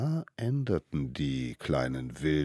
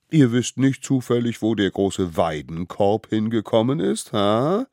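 higher, loud probing question; scolding elders; 6 semitones higher, 6 dB louder than usual. A narrator's reading. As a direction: press the culprits with feigned innocence. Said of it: "einen Korb voller Anpfiffe". (laut und spitz nachgefragt)

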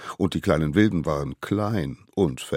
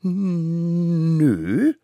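long high-pitched drawn-out no; stubborn refusal; 12 semitones higher, 3 dB louder than usual. A narrator's reading. Smallest savage admits nothing to the court, defiant. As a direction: stretch it cheekily like a defiant child.

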